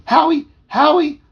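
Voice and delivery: high, loud excited call. A narrator's reading. Toby calls to his dog Howie, high shouted call. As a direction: shout the dog's name from afar. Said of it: "It sounded like this".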